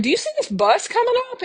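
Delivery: startled question. Retold or imitated. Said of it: "I'm like".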